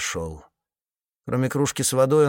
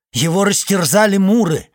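agitated; 9 semitones higher, 8 dB louder than usual. Acted. Iceman reports grim news.

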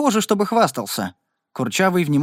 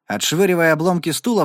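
ordinary delivery, nothing marked

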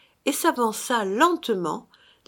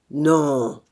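low, heavy drawn-out denial; grudging phantom; 4 semitones lower, 4 dB louder than usual. A narrator's reading. Phantom admits it to his brother, low and heavy. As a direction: answer low, as a ghost compelled.

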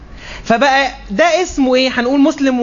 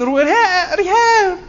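mock squeal of recognition; 8 semitones higher, slower than usual. Gossip mimic recalling Reham.

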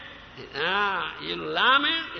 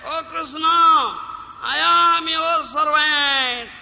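higher, louder, slow plea; crying out to Krishna; 7 semitones higher, 8 dB louder, slower than usual. Acted. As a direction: call out to God with all your heart. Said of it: "cry and say".

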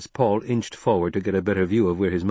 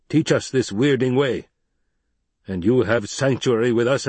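stern Fremen leader. Acted. Stilgar speaks to Jessica.

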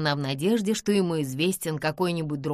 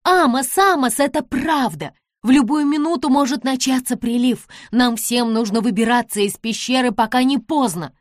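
high, loud desperate shout; girl yelling desperately from the ladder; desperate cry; 5 semitones higher, 7 dB louder than usual. A narrator's reading. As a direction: scream a warning from the stairs. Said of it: "отчаянно закричала с лестницы".

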